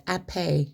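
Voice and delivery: "ape" drawn out as two syllables, "ap-ay"; regional pronunciation. Shown as a demonstration. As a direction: end "ape" on a long AY, no H. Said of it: "they say ape".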